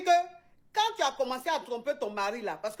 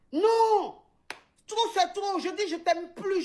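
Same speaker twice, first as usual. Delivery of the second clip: retold angry cry; 7 semitones higher, slower than usual. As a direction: burst out against the husband. Wife's outburst in acted quarrel. (cri aigu et fort d'une épouse excédée)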